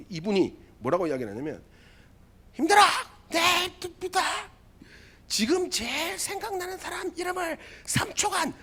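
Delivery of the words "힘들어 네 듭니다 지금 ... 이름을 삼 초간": drill instructor. Retold or imitated. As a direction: shout orders at the recruits in the rain. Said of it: "막 이렇게 이야기하는 거예요".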